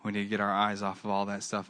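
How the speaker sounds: normal talking voice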